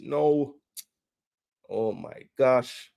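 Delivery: usual speaking voice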